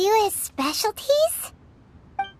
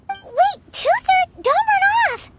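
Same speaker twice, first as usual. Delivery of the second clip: calling out; 12 semitones higher than usual. Acted. Calling after Tusser as he runs off, high urgent call. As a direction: shout after him to stop.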